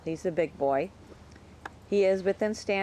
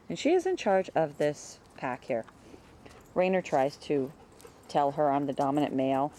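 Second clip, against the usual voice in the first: usual speaking voice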